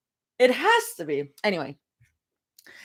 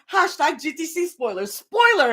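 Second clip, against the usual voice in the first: mock spoiler tag; 7 semitones higher than usual. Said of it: "they're like".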